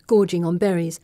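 plain reading voice

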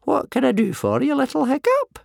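high-pitched wheezing elder; wheezy old man. A narrator's reading. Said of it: "wheezed old Wrinkly".

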